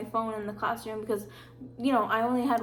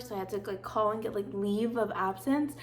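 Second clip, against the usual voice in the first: usual speaking voice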